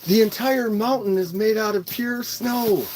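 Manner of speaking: movie quote voice. Retold or imitated. Quoting movie line.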